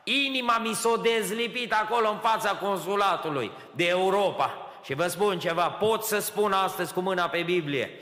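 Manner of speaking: loud, high, fast preaching climax; full-force preaching. Acted. Preacher testifying to the congregation, fiery full-force preaching.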